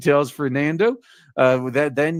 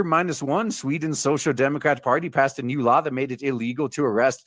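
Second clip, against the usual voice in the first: normal talking voice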